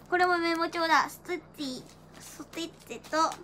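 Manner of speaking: ordinary talk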